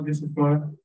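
usual speaking voice

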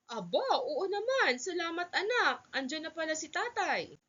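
high, lively motherly voice; pleased mother. Acted. Mother thanking helpful son, pleased. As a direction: thank the child with pleased surprise, pitched high.

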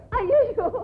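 high sobbing wail of grief; wailing cry. Acted. Widow wailing in grief, sobbing cry. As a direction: let out a broken wail.

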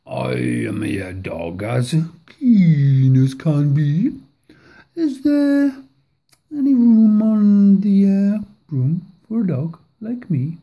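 a hint of an eager, hopeful dog; lightly voiced dog begging to join. A narrator's reading. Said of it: "eagerly said".

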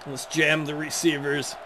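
ordinary talk

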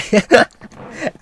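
a big high shriek of a laugh; loud laugh. Real, not acted. Fan delighted that Luke mocks The Last Jedi, loud high laugh. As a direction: crack up hard at the joke.

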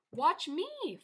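light, high-pitched call to friends. A narrator's reading. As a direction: call out to get them watching. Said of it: "Fox called out".

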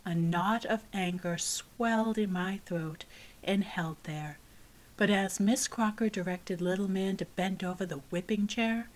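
usual speaking voice

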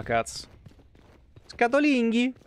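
ordinary talk

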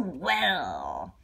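low satisfied well. Acted. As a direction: drop it low, pleased and knowing. Circus man addresses George.

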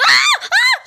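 shrill panicked scream while fleeing; high scream. Real, not acted. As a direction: let out a terrified scream.

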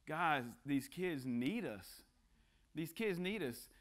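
slow, weighty plea with a stress on "guys"; slow earnest plea. Acted. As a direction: let "guys, these kids need us" land slowly and heavily.

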